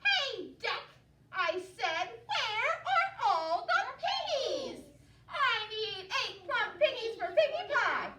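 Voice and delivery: high, loud yell at the duck; shouting witch. Acted. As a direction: bark at the duck. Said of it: "shouted to a duck".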